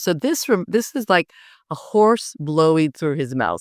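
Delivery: ordinary delivery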